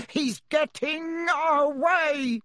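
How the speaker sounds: furious uncle shouting, drawn out and high; roared. Acted. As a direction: bellow it like an angry bull. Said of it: "roared Uncle Vernon".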